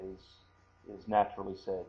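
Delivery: ordinary talk, nothing marked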